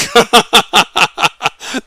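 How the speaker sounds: amused laugh; cracking up; short laugh. Real, not acted. Laughs after swearing.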